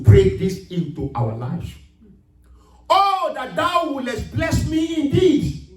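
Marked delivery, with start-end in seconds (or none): 2.9-5.7 slow, high, ringing cry of the prayer; proclaiming prayer. Acted. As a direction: lift it up to God as a plea. Preacher proclaims Jabez's prayer.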